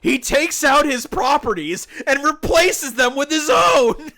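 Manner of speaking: replayed frustrated outburst; exasperated. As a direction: throw up your hands at the screen. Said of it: "I said exasperated".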